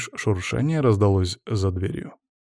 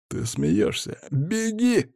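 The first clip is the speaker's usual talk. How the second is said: mocking laugh; 5 semitones higher, much slower than usual. A narrator's reading. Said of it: "хохотнул он".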